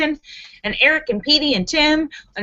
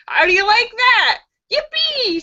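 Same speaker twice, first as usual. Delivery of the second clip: put-on high, gleeful yippee from a child; excited child scream; 10 semitones higher than usual. Bobby jumping up and down, high excited yippee. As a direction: shriek it like a thrilled kid jumping. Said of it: "screams Bobby, jumping up and down".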